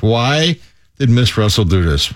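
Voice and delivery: ordinary delivery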